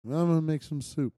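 mock mournful. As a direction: sing it like life is hopeless. Quoting sad lyrics in a low voice.

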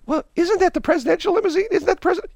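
very high, squeaky put-on voice; high-pitched comic bystander voice; drive-through worker. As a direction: pipe up way high as a startled worker at the window. Drive-through worker answers the order.

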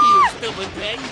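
high, loud, furious insult; screamed insult. Acted. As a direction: scream it in rage.

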